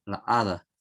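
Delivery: la hada said as the wrong form. Shown as a demonstration. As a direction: say la hada as the mistake. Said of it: "Nunca se dice".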